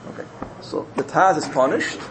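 plain reading voice

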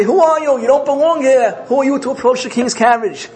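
high, loud acted rebuke; indignant challenge. King's officers turn away the intruder, high indignant challenge.

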